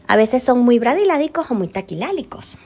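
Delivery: ordinary talk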